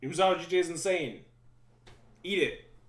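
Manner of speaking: loud, high triumphant gloat; hype gloating shout. Acted. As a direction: crow over the win, loudly. Gloating over winning card.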